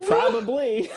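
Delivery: breaking up mid-word, high and loud; can't hold the laugh in. Real, not acted. Presenter cracks up at the bake sale slide, laughing.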